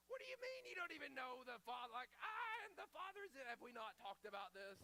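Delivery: high, loud exasperated outburst, then settling; exasperated disbelief. Retold or imitated. Jesus to disciples who don't get it, high exasperated disbelief.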